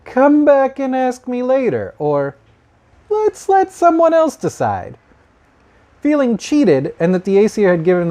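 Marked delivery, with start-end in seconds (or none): 0.0-1.9 mock oracle voice, pitched higher and slowed down; lightly hammed-up vague prophet, higher and slow; dithering oracle. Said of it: "would say things like". 3.1-5.0 mock oracle voice, pitched higher and slowed down; lightly hammed-up vague prophet, higher and slow; dithering oracle. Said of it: "would say things like".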